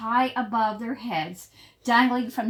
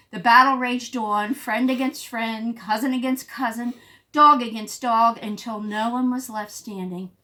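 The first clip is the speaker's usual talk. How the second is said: plain reading voice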